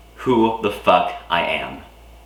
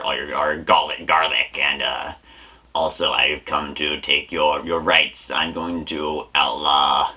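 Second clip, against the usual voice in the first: low, lightly voiced villain Wario; 6 semitones lower than usual. Wario announcing his plan to the audience, low villain voice. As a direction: play a low scheming Wario. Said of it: "Wario voice".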